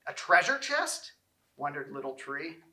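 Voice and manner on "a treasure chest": high, eager wondering; excited guess. A narrator's reading. Little Tree guessing what he'll become, in a high eager voice. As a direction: dream big with a hopeful lift.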